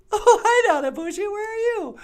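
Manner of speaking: very high, loud cartoon puppet greeting; high puppet bonjour. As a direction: squeak it out like a bubbly puppet. Puppet character voice.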